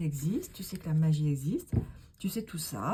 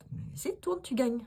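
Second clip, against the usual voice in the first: much higher, pleading push; high coaxing; 7 semitones higher than usual. Pretend. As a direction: coax her to spin. Coaxing the reluctant one. (encouragement aigu)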